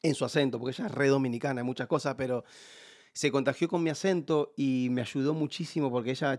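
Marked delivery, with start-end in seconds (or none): none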